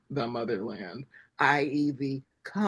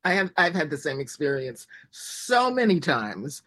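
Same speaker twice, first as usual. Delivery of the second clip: usual speaking voice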